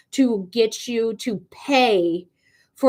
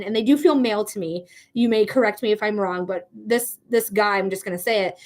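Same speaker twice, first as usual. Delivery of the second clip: normal talking voice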